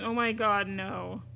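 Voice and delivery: ordinary delivery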